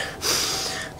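long tired breath out; heavy exhale. Real, not acted. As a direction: blow out a weary breath. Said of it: "I'm out of breath".

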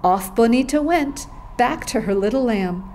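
ordinary delivery, nothing marked

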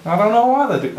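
attempted Australian. Echoing his mate in a failed Australian accent. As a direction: have a bad go at an Australian accent. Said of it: "I can't even do Australian".

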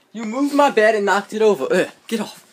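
loud, high-pitched complaint; exasperated sibling shout; angry scolding. Real, not acted. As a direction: snap at them to back off, loudly. Squabble over the knocked bed.

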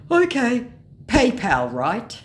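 loud, high mock scammer. Scammer trying to get money by PayPal, mock hopeful. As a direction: punch each request as a hopeful scammer. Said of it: "he says".

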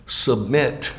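"submit" set apart by pauses, low; stress on SUBMIT. Shown as a demonstration. Preacher telling listeners which word to emphasize, "submit" set apart. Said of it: "place the emphasis on the word".

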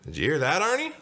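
high question leaping up at the name; high, rising check-in; baker on phone. Acted. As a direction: ask pointedly, swooping up at the end. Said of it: "the baker asked".

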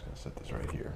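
hushed aside; whispering. Real, not acted.

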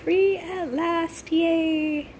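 high, drawn-out little hooray; playful mock cheer. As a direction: stretch it out high in toy-like celebration. Playful cheer after rescuing the boy.

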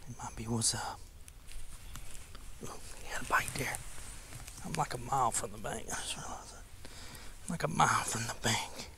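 hushed whisper so the fish won't spook; low whisper by the water. Real, not acted. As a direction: keep it to a breath while fishing. Quietly fishing near the fish.

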